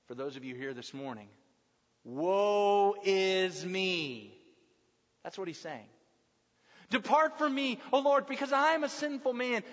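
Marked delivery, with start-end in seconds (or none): 2.0-4.4 drawn out, very slow lament, a touch louder. Acted. Preacher voicing Peter's despair, slow mild lament. 6.9-9.6 higher, louder, insistent plea of Peter; urgent plea. Acted. Peter pleading with Jesus to depart, raised urgent plea.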